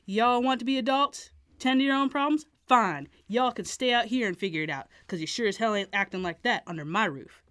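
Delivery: put-on father yelling at kids; angry father.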